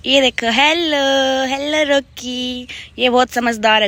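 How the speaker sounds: praising the dog. Real, not acted.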